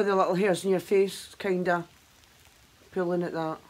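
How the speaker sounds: stiff mask around lips; wincing from tugging skin; speaking while peeling mask. Real, not acted. Seen 1: Pink peel-off mask covers face; she peels it while talking.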